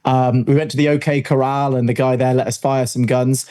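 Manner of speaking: usual speaking voice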